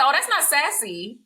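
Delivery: high, pointed comeback; high pointed classroom retort. Retold or imitated. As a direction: push back hard on the word sassy.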